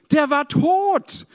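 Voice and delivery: high, loud and slow; dramatic emphasis. Real, not acted. Preacher stressing that Lazarus was dead, high and slow. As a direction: stretch it out for effect.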